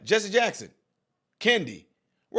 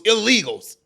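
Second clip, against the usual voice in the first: "illegals." punched louder; stress on ILLEGALS; 2 semitones higher, 5 dB louder than usual. Real, not acted. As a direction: hit "illegals." hard, with a falling end. Repeats contested word pointedly.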